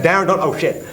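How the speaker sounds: high, urgent retold warning; alarmed warning. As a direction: try to stop him, then give up in alarm. Drummer failing to stop him.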